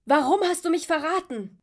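shouting over battle noise; high, loud furious yell. Acted. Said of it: "brüllte Artus gegen den Schlachtenlärm".

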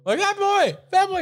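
calling out. Retold or imitated. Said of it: "I'm like".

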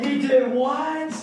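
high, loud, drawn-out disbelief; mock shock. Hosts posing for a clickbait thumbnail, hammed-up shock. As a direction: stretch it out in hammed-up astonishment.

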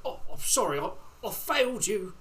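imitating a sorrowful old butler; Alfred imitation. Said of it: "Alfred, Michael Caine".

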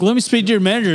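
high, pushy demanding customer voice; a put-on Karen asking for the manager; mocking an angry caller who wants the manager; entitled customer. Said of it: "They're like".

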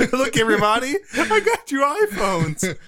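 a giddy preacher cracking up; laughing cult leader voice. Pretend. Jim Jones impression laughing.

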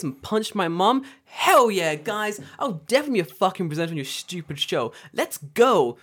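loud, high, overexcited fake enthusiasm; hammed-up gung-ho employee hiding resentment; mock-eager yes. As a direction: gush it high and loud, dripping with sarcasm. Sarcastic acted eager acceptance.

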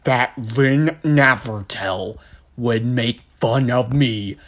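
slow, halting dopey impersonation; slow, heavy imagined politician voice; Kevin from The Office. Acted. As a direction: plod through it word by word. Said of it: "you just sound like Kevin from The Office".

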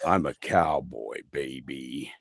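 slow cowboy drawl, sinking low; American cowboy. Shown as a demonstration. Showing friends his standard American, cowboy voice. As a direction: play a cowboy introducing himself, dropping deep at the end. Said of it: "cowboy, which is like the, the standard one".

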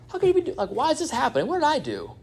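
high-pitched protesting whine, hammed up; disputing. Preacher acting out someone calling God into question, high mock protest. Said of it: "That's disputing".

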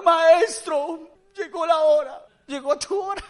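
high, loud wail of alarm; hammed-up panic, vowels drawn out; frantic disciples. Disciples waking Jesus in the sinking boat, frantic.

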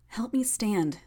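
strained, pained request. Acted. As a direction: force it out through pain. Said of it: "Tattletale's voice was strained".

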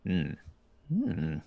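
a slow, pondering hum; thinking hmm. Real, not acted. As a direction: hum while you think it over. Asked for a memory.

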